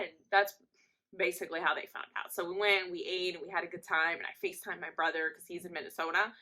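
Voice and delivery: ordinary talk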